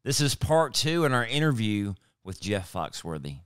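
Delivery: upbeat podcast host intro read; polished radio-style opener; show intro. Acted. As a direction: welcome the listeners like an announcer.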